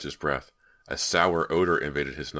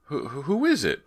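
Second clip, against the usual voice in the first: stammering; 11 semitones higher, 5 dB louder than usual. A narrator's reading. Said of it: "Brant stammered".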